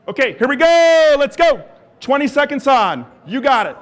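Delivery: loud, high coach's countdown call; loud start call. Real, not acted. Coach launching the interval to the walkers, loud and high. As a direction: fire up the room to go.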